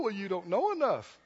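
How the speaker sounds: usual speaking voice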